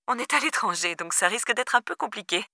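a touch of amusement, kept quiet and high; lightly amused mother. A narrator's reading. Mother brushing off her daughter from abroad, lightly amused. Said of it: "s'esclaffa sa mère".

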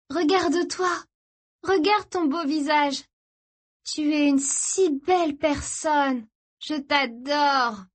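high, slow, euphoric gushing; dreamy, drugged devotion; gushing sister. Acted. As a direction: adore him with drawn-out bliss. High sister gushes over brother.

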